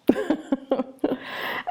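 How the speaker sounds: short high chuckle; laugh. Real, not acted. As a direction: chuckle lightly at your own remark. Laughs at own remark.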